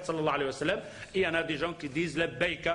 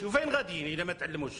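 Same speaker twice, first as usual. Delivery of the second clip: usual speaking voice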